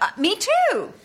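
high, ditzy airhead line. Acted. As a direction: play it as a dim airhead. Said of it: "it was the".

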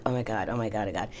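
low, soft, rapid muttering; hushed "oh my God" under the breath; muttered mock dread. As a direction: murmur to oneself in retold dread. Retold private dread going down.

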